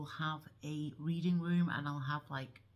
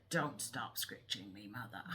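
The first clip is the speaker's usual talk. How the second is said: a lower, softer put-on voice for the dog; dog's voice; 8 semitones lower, 6 dB quieter than usual. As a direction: voice the dog demanding more scratches, low and soft. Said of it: "she's like".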